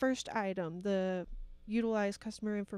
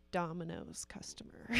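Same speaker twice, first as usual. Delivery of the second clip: whispering; 5 semitones lower, 7 dB quieter than usual. Real, not acted. Sheepish confession.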